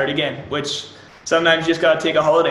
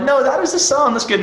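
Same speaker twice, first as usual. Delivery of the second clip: little fit; 10 semitones higher than usual. Retold or imitated. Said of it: "I throw a little fit".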